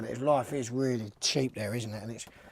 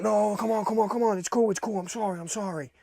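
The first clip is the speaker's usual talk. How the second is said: higher, pleading, mock-desperate; high pleading backpedal; 8 semitones higher than usual. Passenger begging the driver to stay, high and pleading. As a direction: imitate him begging to stay on, high and rushed. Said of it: "I think he was like".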